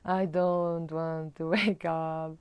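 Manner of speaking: slow, whiny groggy complaint; mock sleepy grumble; groggy reluctance. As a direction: drag it out like someone who won't get up. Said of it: "the pattern of snoozing".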